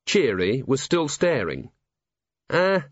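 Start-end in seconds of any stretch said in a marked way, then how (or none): 2.5-2.8 high, hesitant reply; high-pitched and halting; managed, awkward thanks. A narrator's reading. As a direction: squeeze out an awkward thank-you. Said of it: "he managed".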